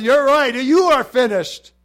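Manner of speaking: loud, high, punched-out retort; shouted retort. Acted. Preacher voicing the answer with Satan, shouted retort. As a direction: throw it back at full volume. Acts reply to Satan's defeat.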